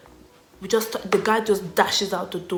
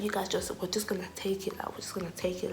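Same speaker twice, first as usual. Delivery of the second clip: whispered, softer, sharing a secret plan; conspiratorial whisper; 10 dB quieter, faster than usual. Retold or imitated. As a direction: hush it like a getaway plan. Guy explains the plan secretly.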